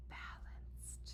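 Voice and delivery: a hushed, breathy discovery; whispered gasp. A narrator's reading. Egg discovering calm, soft whispered wonder. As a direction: gasp then whisper the word very softly. Feeling like myself again.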